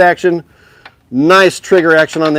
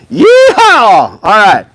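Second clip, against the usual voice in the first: high, loud cowboy whoop; yeehaw cheer; 5 semitones higher, 6 dB louder, much slower than usual. Real, not acted. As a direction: throw out a big cowboy yeehaw. Cowboy hat Trombonanza cheer.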